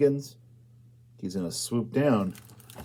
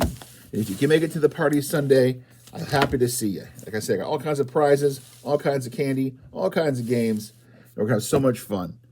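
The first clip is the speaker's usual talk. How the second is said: ordinary delivery, nothing marked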